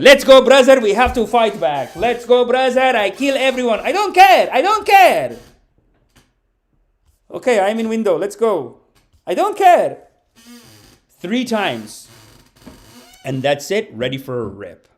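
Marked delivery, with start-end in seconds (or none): 0.0-10.0 [name]. Acted. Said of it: "And he goes".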